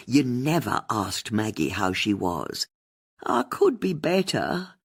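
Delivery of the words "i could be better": high, slow and mournful. A narrator's reading. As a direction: sigh it out as a put-upon complaint. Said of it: "Maggie said mournfully".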